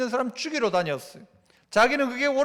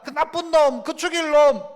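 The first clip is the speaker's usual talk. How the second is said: mock angry curse; 6 semitones higher, 6 dB louder than usual. Mimics schemers cursing someone.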